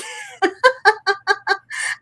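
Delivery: high giggle in quick bursts; high staccato laugh. Acted. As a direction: break into a high, rippling laugh. Laughs mid-comeback at opponent.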